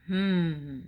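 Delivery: sly thinking hum; mischievous hmm; scheming hmm. Acted. Gorilla scheming sound.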